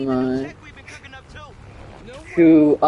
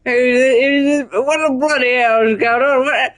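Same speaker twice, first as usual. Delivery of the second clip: hammed-up British accent, pitched up; mock British accent; 6 semitones higher than usual. Gamer swearing at the game in a mock British accent. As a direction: play a cartoon Brit swearing at the game. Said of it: "It's me becoming British".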